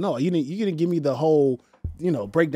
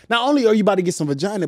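ordinary talk, nothing marked

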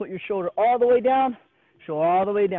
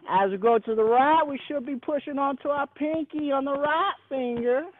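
Texan voice; 3 semitones higher than usual. Shown as a demonstration. Coach showing the class a Texan voice while teaching pancake wrist circles, Texan accent. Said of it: "You wanna hear my Texan voice?".

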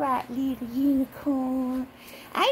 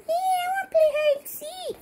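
very high little toy unicorn; 14 semitones higher than usual. Pretend. Unicorn answers about hide and seek, high squeaky voice. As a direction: squeak it like an eager tiny toy. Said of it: "don't you, unicorn?".

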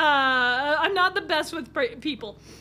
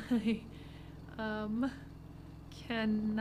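much softer, half-spoken asides; quiet muttering; 4 semitones lower, 11 dB quieter than usual. Real, not acted. Player muttering jokes to the driver, much softer. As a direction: mumble the lines under your breath. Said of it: "muttering quiet silly asides".

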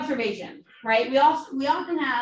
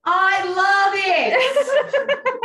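high, loud, slow laughing delight; 10 semitones higher, 8 dB louder, much slower than usual. Real, not acted. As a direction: gush with a laugh. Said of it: "delighted outburst".

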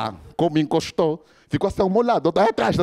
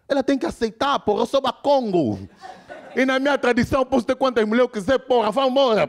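defiant boast; 7 semitones higher than usual. Retold or imitated. Congolese friend defends polygamy.

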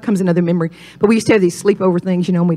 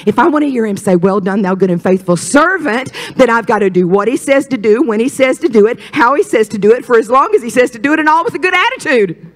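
rising, insistent pulpit cadence; full-force preaching; 6 semitones higher, 5 dB louder than usual. Real, not acted. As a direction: build higher with each clause.

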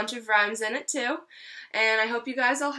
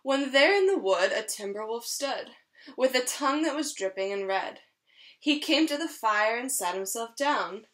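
lively storybook read-aloud; reading to children. Acted. Teacher reading a rhyming story to her class, lively. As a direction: read it like a picture book for a class. Reads picture book to children.

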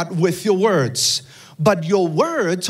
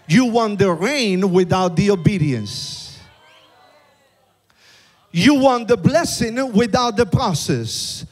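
preaching at full force; 3 semitones higher than usual. Acted. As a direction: chant it like a revival preacher.

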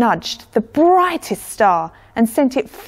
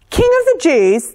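high, loud outraged shout; king's shout; 9 semitones higher, 5 dB louder than usual. A narrator's reading. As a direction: shout it as an affronted king. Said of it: "the king shouted".

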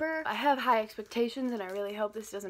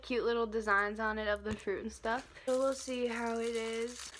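normal talking voice

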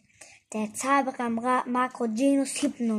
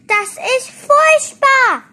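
very high, loud outcry; dismayed cry; 15 dB louder than usual. A narrator's reading. Ette can scare nobody anymore, dismayed cry. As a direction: wail it at full voice, high up. Invisible character's outburst of dismay.

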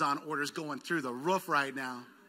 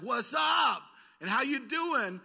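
a light, higher, louder breezy hello; breezy casual greeter; 7 semitones higher, 6 dB louder, slower than usual. Retold or imitated. Pastor mimicking friends' shallow small talk, breezy.

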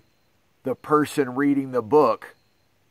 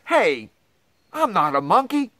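mock protest; 6 semitones higher than usual. Protests book's claim.

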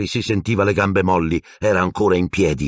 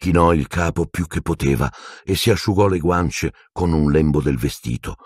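ordinary delivery

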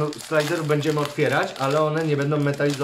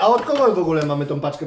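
usual speaking voice

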